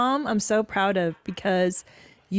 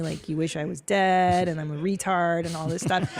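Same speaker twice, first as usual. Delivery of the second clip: ordinary delivery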